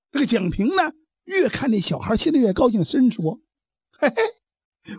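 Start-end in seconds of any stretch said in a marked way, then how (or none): 4.0-4.9 high delighted little laugh; gleeful chuckle. Acted. Jiang Ping pleased with his new pupil, chuckling gleefully. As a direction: chuckle with glee.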